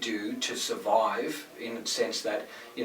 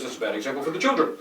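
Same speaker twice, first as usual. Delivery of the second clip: quick, higher, mock-disapproving voice; prim critic; 4 semitones higher, 3 dB louder, faster than usual. Mocking the critic who scolds a public figure, in a quick, higher, prim voice. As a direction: toss it off like a pearl-clutching busybody. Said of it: "he goes".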